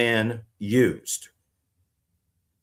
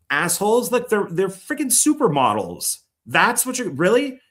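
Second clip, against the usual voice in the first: exasperated; 7 semitones higher than usual. Real, not acted. Ranting back at imagined complainers.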